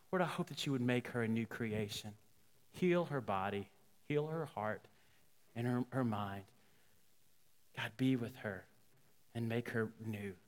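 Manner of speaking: low, soft, pausing prayer; gently intercede for someone; retold hushed, earnest prayer; praying. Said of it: "he just begins to pray".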